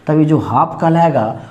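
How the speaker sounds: normal talking voice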